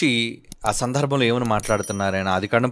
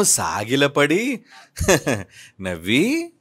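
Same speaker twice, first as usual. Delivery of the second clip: slow, with a laugh acted out on నవ్వి; slow retelling that breaks into a laugh; 3 semitones lower, 4 dB quieter, much slower than usual. Shown as a demonstration. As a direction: laugh through the verse to show Abraham laughing.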